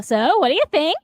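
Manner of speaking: ordinary delivery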